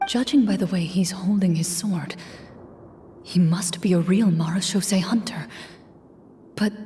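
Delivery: soft breathy whisper; whispered aside. Real, not acted. Game master whispering a warning to the players. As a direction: hush it under your breath.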